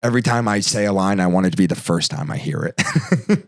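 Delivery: low, brisk star laying down terms; Jack Nicholson. Retold or imitated. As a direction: lay down your demand in a low voice. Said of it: "He's like".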